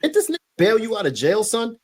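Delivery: high, fast, incredulous question; can't believe what he's hearing; stunned disbelief. Real, not acted.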